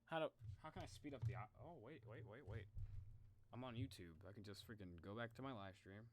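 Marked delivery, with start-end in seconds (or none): none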